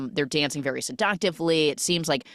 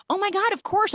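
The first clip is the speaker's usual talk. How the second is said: gushing recognition. Retold or imitated. Acted gushing recognition of acquaintance.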